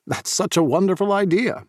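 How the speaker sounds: bright, eager praise; excited. A narrator's reading. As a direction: gush over the idea. Narrator voiced enthusiastic.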